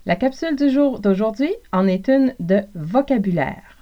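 ordinary talk, nothing marked

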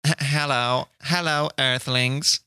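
slow, stilted robot greeting. Retold or imitated. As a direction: speak like a toy robot. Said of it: "the robot's clearly talking while he's dancing".

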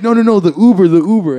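loud, high, urgent retold plea; mock panicked insistence; frantic explaining. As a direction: act out hurriedly clearing up a mistake. Frantically explaining mistake to cops.

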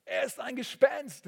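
high, loud panicked shout; terrified cry. A narrator's reading. Disciples in the storm seeing a figure on the water, shrieking in fear. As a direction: cry out in sudden terror as if seeing a ghost. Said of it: "riefen sie".

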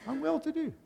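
high, soft little reply; modelled reply. Shown as a demonstration.

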